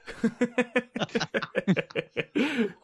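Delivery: high, long giggle; high-pitched run of laughter; laughing. Real, not acted. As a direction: laugh helplessly at being teased. Laughs after being teased.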